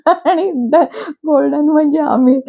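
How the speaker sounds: real laughter breaking through the words; giggling through the line; laughing. Real, not acted. Laughing at Rakhi's dialogue.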